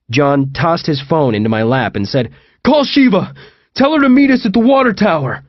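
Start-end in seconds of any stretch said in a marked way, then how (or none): 2.6-5.4 high, raised-voice order; urgent shout. A narrator's reading. John tossing his phone to his friend during a chase, urgent shout. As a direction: bark out urgent instructions.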